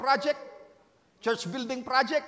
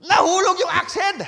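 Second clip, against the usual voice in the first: startled higher cry; alarmed cry; 6 semitones higher, 7 dB louder than usual. Acted. Prophet's son crying out over the lost axe head, alarmed. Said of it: "napasigaw siya".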